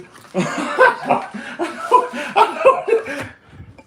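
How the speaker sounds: shrill falsetto cackle; a loud high-pitched giggle fit. Real, not acted. Laughs after silly ditty.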